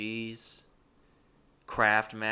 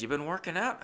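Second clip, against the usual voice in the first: higher-pitched roommate impression; old roommate; 7 semitones higher than usual. Old roommate comes home to narrator, imitated high question. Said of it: "he came home and he said".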